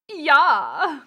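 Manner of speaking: hammed-up flamboyant German ja; German accent. Streamer joking in the character's voice, big German accent.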